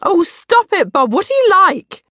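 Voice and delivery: playfully scolding, high and loud; a mum fending off her husband, high-pitched; Mum's voice. Retold or imitated. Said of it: "Mum said".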